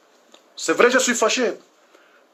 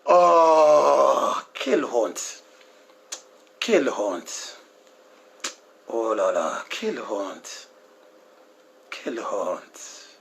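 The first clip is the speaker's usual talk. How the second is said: low, much softer, slow sorrowful quelle honte; grieving lament; 12 semitones lower, 3 dB quieter, slower than usual. Real, not acted. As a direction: mourn it quietly as if near tears. Preacher laments women drinking.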